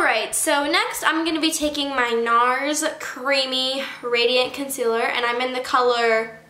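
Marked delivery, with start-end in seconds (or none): none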